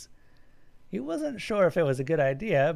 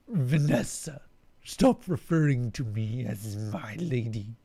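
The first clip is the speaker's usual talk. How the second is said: commanding alien; 3 semitones lower, slower than usual. Acted. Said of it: "the larger alien commanded".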